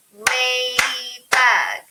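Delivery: "Ladybug" very slow and loud, beat by beat; "Ladybug" chanted in separate chunks; syllable by syllable. Shown as a demonstration. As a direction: clap out "Ladybug" with each syllable held. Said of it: "We're gonna do the clapping way".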